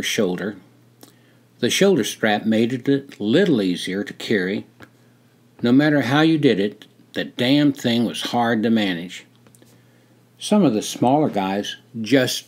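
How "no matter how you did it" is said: usual speaking voice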